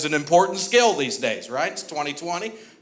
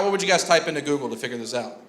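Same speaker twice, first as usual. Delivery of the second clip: ordinary delivery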